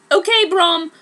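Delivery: high, loud eager call; rally the broom; eager rider. Acted. A student urging a broom to fly. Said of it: "Braum said".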